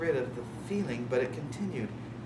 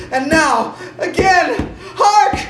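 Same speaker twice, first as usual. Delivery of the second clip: very high and loud, each "Louder!" a cry; panicked cries of Louder; hysterical; 14 dB louder, slower than usual. Acted. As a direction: shriek it out in panic. Hysteria peaks.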